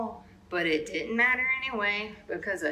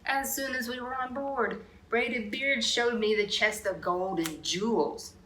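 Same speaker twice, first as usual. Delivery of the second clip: ordinary delivery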